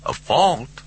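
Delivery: high, puzzled Southern caller; speakerphone voice. A narrator's reading. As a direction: query it down a tinny phone line. Hubble's voice over the speakerphone.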